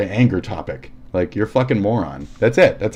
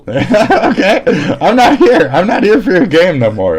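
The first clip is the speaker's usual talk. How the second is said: high, loud laughing refusal; 8 semitones higher, 7 dB louder than usual. Real, not acted. Refusing the friend's demo, laughing loud and high. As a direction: laugh it off while refusing, louder.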